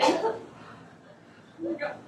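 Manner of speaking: panicked. Acted. Friend shot, panicking.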